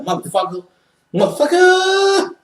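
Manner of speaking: high, stretched-out outburst; mock freakout. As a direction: yelp it in disbelief. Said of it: "I was like".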